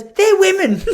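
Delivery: put-on high, loud kid voice dissolving into laughter; high laughing kid's blurt. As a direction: blurt it out then crack up.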